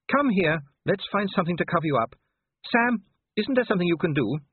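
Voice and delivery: high, motherly lady's voice; Sybil. A narrator's reading. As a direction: take charge kindly, pitched well up. Sybil takes charge, appeals to husband.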